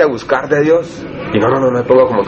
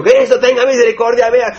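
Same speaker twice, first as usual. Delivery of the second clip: much higher, straining cry for mercy; mock outcry; 8 semitones higher than usual. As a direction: cry out to Christ to make a scene, but not at full volume. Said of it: "Hago un escándalo hasta que me escuche". (clamor agudo, a medio grito, pidiendo misericordia)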